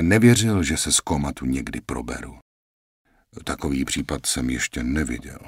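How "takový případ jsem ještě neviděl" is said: matter-of-fact. Acted. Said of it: "pronesl věcně".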